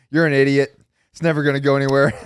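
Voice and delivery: mock scoff. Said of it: "everybody was probably like".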